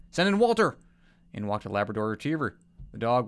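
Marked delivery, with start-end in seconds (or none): 0.1-0.8 calling out. Acted. Doctor calls next animal.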